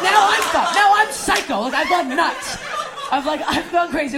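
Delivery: loud, high-pitched freak-out; hammed-up unravelling, easing slightly by the end; frantic panic. Declares she has gone psycho.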